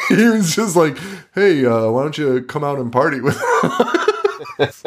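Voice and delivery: retelling through rising giggles; laughing. Real, not acted. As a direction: crack up while imitating a casual invite. Retold invite, breaking into laughter.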